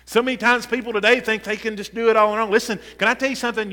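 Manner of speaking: loud, insistent church exhortation; fired-up preaching. Real, not acted. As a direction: preach it with rising urgency to the congregation. Preaches at full force.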